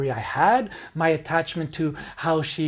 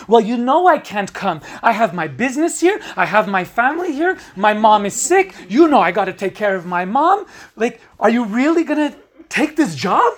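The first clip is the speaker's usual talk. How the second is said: louder, higher, fast protest; upset pleading; 5 semitones higher, 7 dB louder, faster than usual. Acted. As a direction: argue to make her stay. Breakup quarrel, pleading objections.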